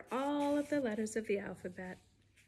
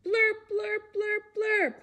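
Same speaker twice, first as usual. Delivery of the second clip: high, loud blaring blurps; silly horn sounds; 10 semitones higher, 5 dB louder than usual. Pretend. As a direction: honk like a horn. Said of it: "like a horn".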